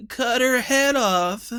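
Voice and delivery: gloomily eager; emo dwarf. A narrator's reading. Emo dwarf urging the girl's beheading, listless voice.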